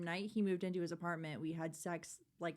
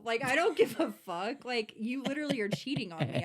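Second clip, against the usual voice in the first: louder, heated retelling of the fight; retold angry outburst; 3 semitones higher, 7 dB louder than usual. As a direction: snap it at him like in the fight. Retold fight with cheating boyfriend.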